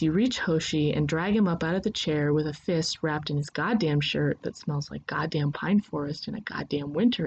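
plain reading voice

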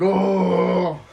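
startled oh. Retold or imitated. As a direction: jump at the noise. Startled by sudden chainsaw.

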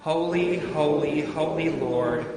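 ordinary delivery

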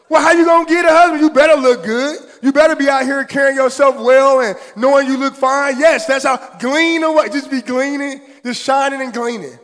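loud, fast, high-pitched preaching climax; fiery preaching. Acted. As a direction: shout it out to the congregation, fired up. Preaching at full force.

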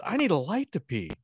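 lightly voiced high-pitched kid; touch of amazed child; stepson. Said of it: "He goes".

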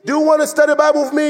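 high loud eager greeting to Joe; friendly invitation. Retold or imitated. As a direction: call out to Joe brightly. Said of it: "I go".

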